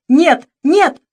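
high, loud repeated cries; mother's panicked outcry; frantic refusal. A narrator's reading. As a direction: shout no in desperation.

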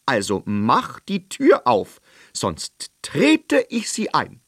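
hohe, laute Königsstimme, herrisch fordernd; gebieterischer König. Acted. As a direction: ein König, der keinen Widerspruch duldet. (König verlangt vom Zauberer Einlass, hoch und herrisch)

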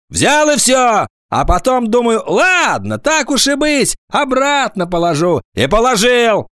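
high, emphatic schoolboy voice; high-pitched, stubborn account; defiant confession. A narrator's reading. Agafonov defiantly insists he took it.

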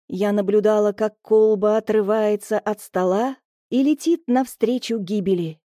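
usual speaking voice

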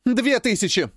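high shouted bid; shouting. Acted. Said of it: "кричит девушка".

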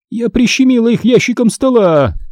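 high, plaintive complaint of pain; pained moan. A narrator's reading. Teacher telling the nurse about the drawer, moaning in pain. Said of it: "простонала миссис Вандерхоф".

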